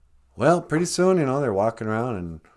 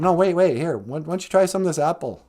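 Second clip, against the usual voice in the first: higher-pitched, eager coaxing; acted urging; 8 semitones higher, 4 dB louder than usual. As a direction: play a wife pressing him to try it. Eve urges Adam to eat.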